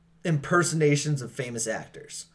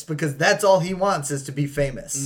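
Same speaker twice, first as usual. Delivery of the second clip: ordinary talk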